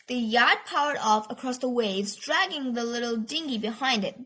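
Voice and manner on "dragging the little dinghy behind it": plain reading voice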